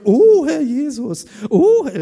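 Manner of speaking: slow, high and loud hammed-up plea; mock pious prayer. Preacher mocks his own prayer habit: hammed-up pious plea. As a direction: overdo the churchy prayer cliché. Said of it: "ich würde dauernd sagen".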